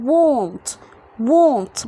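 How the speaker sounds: WANT said as "wont". Shown as a demonstration. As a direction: give "want" an o vowel after the w. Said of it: "هنطقها غالبًا O". (نطق want بصوت O بدل A)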